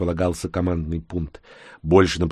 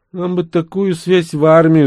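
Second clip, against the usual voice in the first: envious; 11 semitones higher, 8 dB louder than usual. A narrator's reading. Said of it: "завистливо заявил Серго".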